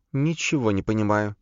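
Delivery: high-pitched, seriously confused questions; earnest questioning. A narrator's reading. Соня seriously asking a stranger where Саша is, earnest confusion. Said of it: "со всей серьёзностью спросила Соня".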